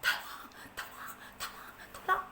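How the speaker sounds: breathy whispered tolong; a drowning ant's tiny whisper; whispered cry for help. Acted. Ant calls for help in a whisper.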